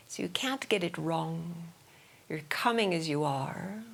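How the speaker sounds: calm, measured yoga nidra guiding, with long pauses; guided meditation. Real, not acted. Yoga teacher settling listeners into breathing, guided meditation voice. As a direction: ease listeners into their breath, unhurriedly.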